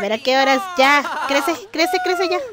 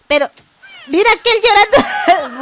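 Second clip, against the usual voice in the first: excited outcry; 8 semitones higher, 8 dB louder than usual. Real, not acted. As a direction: point it out in loud disbelief. Child starts crying at party. (exclamación alta y fuerte)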